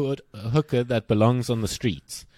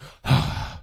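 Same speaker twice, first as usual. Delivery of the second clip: imitated sigh. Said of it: "he's like".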